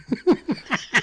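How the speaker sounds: high, sudden laugh at his own joke; burst of laughter. Real, not acted. Host laughing at his own movie description.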